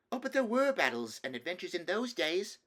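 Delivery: put-on small boy insisting on his stories; eager little boy. Nephew arguing back to the king, eager little boy.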